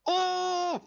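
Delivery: "oh" agudísimo y sostenido; chillido de euforia. Real, not acted. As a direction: suelta un gritito agudo de emoción. Reacción eufórica al tráiler. (chillido emocionado en falsete)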